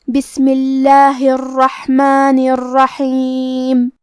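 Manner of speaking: slow, melodic recitation; Quranic recitation. Acted. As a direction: chant the surah with tajweed. Recites full passage.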